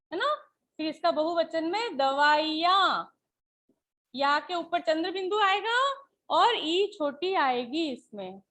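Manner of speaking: ordinary talk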